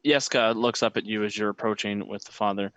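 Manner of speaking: ordinary talk, nothing marked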